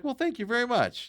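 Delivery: a pleased cashier, lightly imitated; high mock-sweet thank you from the cashier; lightly voiced older lady.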